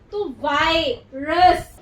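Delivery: वायरस said very slowly and loud, long आई; slow demo word. Shown as a demonstration. Teacher to class, slowly modelling वायरस. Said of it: "आई open हो जाएगा".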